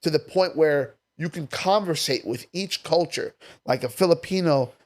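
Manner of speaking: usual speaking voice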